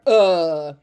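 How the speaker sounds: "uh" held long and loud; drawn-out extra uh. Shown as a demonstration. Teacher showing children the extra sound to avoid, "uh" drawn out. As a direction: drag out a loud, superfluous "uh". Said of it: "we add on an extra".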